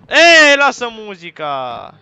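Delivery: high, loud, drawn-out shout; yelled complaint. Real, not acted. Player yelling at the game about music, long shout. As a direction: holler it, stretching the words.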